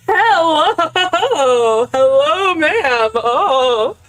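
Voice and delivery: loud high calling hello. Real, not acted. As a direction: call out brightly into the room. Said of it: "I literally just went like".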